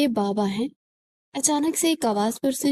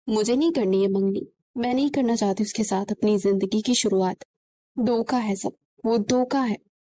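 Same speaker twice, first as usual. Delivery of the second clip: usual speaking voice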